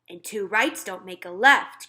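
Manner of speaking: loud cheery retort; cried out. Acted. Giant answering the Queen, cried out loud. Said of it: "cried the B F G".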